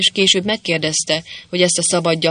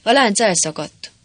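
mildly alarmed, higher-pitched exclamation; frightened cry; 4 semitones higher than usual. A narrator's reading. Crying out that the chain has broken, mild alarm. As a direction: blurt it out with a touch of fright. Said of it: "ijedten felkiáltott".

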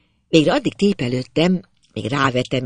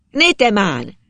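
raised voice, higher pitch; exclaimed call; 5 semitones higher, 4 dB louder, slower than usual. A narrator's reading. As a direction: call out in mild surprise. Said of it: "Kiáltja".

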